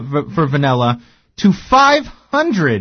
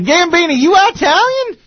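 hammed-up Texas twang; Texan accent. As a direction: holler it like an astonished Texan. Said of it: "He'd be like".

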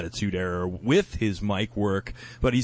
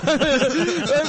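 bursting into a high cackle; high laugh; 6 dB louder than usual. Real, not acted. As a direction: let a big laugh out. Laughing at joke.

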